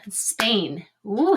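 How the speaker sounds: ordinary talk, nothing marked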